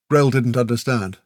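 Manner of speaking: ordinary delivery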